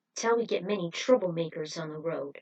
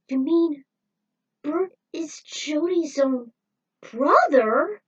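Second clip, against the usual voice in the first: louder and higher, slowing to a burst on brother; shocked disbelief; 8 semitones higher, 8 dB louder, slower than usual. Acted. Friend learning Bert is Jody's brother, shocked. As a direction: stagger through the realization.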